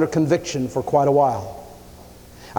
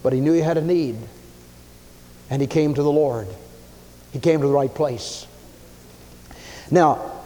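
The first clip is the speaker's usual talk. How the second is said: ordinary talk, nothing marked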